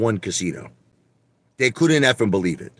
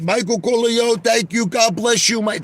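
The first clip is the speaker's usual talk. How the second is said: much higher put-on voice, gushing thanks; grateful Godfather payee; 11 semitones higher than usual. Retelling a Godfather Three scene to his stream, a high gushing put-on voice. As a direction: play the guy handed a million dollar check. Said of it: "He said".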